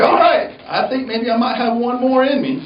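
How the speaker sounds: high and loud, cocky; pumped, daring another round; hammed-up gung-ho; mock bravado. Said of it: "I'm like".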